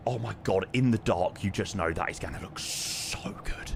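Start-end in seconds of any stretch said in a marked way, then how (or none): none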